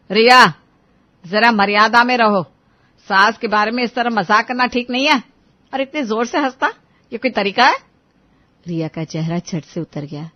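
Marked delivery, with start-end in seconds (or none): 0.1-7.8 loud, sharp reprimand opening on the name; stern scolding. Acted. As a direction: scold the daughter-in-law for joking. Mother-in-law scolds laughing Riya. (सास की सख़्त डाँट)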